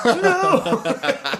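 high squeaky laughing disbelief; shocked squeal. Retold or imitated. Teen caught by his father, squeaking in disbelief.

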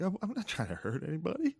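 high, soft mock whimper; lip-quivering near tears. Comic in a network meeting, retold near-tearful whimper. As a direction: plead softly as if about to cry. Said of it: "I felt my fucking lip quiver".

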